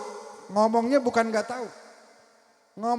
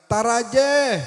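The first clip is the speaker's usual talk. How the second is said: called back from the well; 4 semitones higher, 7 dB louder, much slower than usual. Retold or imitated. Orang Sunda menjawab dari sumur.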